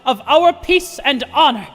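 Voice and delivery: orate like a self-important president; blustery pompous mayor. Acted. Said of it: "I speak to you as Dieter Croy".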